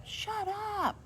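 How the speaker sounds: very high, sing-song "Shut up"; playful shut up. Shown as a demonstration. Vlogger shows the funny way: high playful shut up. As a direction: squeal "Shut up" in fun.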